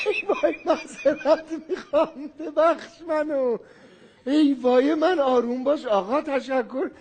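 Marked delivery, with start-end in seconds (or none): 0.1-3.6 slow apology through real laughter; laughing apology. Real, not acted. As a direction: giggle out a sorry. Laughing apology after threat. (عذرخواهی آرام با خنده)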